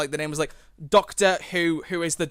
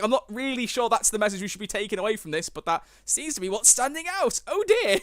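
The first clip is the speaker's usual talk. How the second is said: much higher, rising mock dismay; 7 semitones higher than usual. Reacting to the episode's message, high mock dismay. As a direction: climb to a squeaky oh dear.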